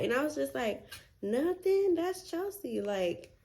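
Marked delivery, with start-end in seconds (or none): 1.2-2.8 much higher, quick innocent brush-off; sing-song innocent lie; fake innocent. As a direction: play it off like nothing happened. Lying to mom about noise.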